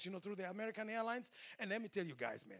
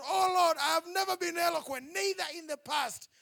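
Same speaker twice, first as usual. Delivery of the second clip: pleading Moses; 7 semitones higher, 7 dB louder than usual. Acted. Preacher acting out Moses again.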